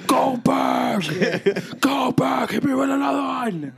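high excited yell; shouting. Retold or imitated. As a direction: yell it out at a crowd.

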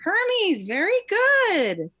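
high, loud burst of praise; excited praise. Real, not acted. Quiz host praising the kid's right answer, high and excited.

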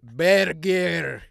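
very slow, loud, word-by-word put-on accent. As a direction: spell it out slowly and loudly, accented. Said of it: "this time speaking slower and louder".

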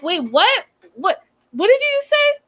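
can't believe what she heard; shocked disbelief. Retold or imitated. A vlogger reacting to Natalie's accusation, shocked disbelief. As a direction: pitch leaping up in disbelief.

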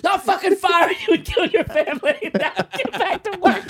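high-pitched comic shouting; hammed-up boss losing it at staff; frantic mock rage; irate boss. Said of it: "your boss, he's grabbing you".

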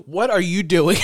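laughing mock yell; mock shout. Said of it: "he said".

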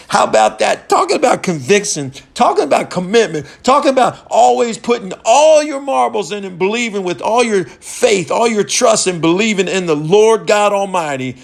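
high, loud, driving preacher; a fired-up preacher stirring the crowd; preaching at full force. Acted. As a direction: hammer it home to the congregation.

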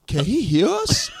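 high, slow put-on voice; drawn-out high question; overheard man. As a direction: imitate someone suddenly worried about being overheard. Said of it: "then another guy goes".

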